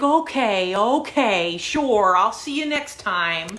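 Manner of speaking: loud, brushing-off agreement; retold patronizing send-off; dismissive humoring. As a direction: humor a crank to get rid of him. Humouring him while ejecting him.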